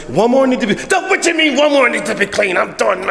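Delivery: high, indignant snap back; annoyed retort. Retold or imitated. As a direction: push back, fed up.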